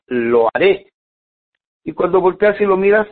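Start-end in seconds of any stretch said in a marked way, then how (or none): 0.1-0.9 low, determined Lo haré with a rising finish; a man who has finally decided; firmest resolve. Acted. The man finally decides, determined Lo haré. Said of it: "dice lo más fuerte".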